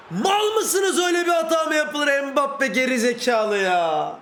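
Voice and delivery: very high shout easing into a drawn-out groan of blame; furious outburst. Real, not acted. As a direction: blow up at a teammate's blunder. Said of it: "beni sinirlendiriyorsun bak". (öfke patlaması, bağırarak)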